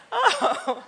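high laughing "oh". Real, not acted. Wife reacting to the small-world news, laughing. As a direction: burst into delighted laughter.

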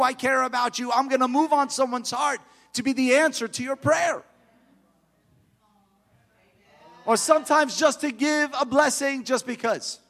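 loud, high, fervent preaching; full-force preaching. Acted. Preacher urging the congregation that God loves to bless, loud and fervent. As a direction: lift the crowd, preach it out.

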